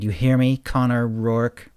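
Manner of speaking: drawn-out shout on the name; yelling. A narrator's reading. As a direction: shout the challenge after someone leaving. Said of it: "Wolf suddenly started yelling".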